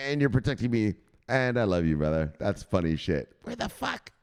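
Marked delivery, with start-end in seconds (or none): 3.4-4.1 very high, soft squeak; mock panic. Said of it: "I was freaked out".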